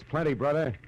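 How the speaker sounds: fired up by the find; excited. Acted. Marlowe excited by his discovery.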